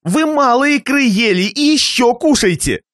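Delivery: высокий, натужно угощающий голос; угощай на грани срыва, высоко; хозяин в лихорадке. A narrator's reading. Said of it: "Зубы хозяина стучали, как в лихорадке". (истерично-любезный хозяин)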